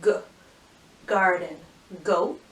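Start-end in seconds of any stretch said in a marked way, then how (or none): 0.0-0.1 "G" as the short sound guh; first sound alone. Shown as a demonstration. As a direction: say "G" as guh, not the letter name. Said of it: "But that first sound".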